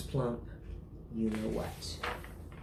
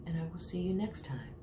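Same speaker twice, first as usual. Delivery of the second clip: usual speaking voice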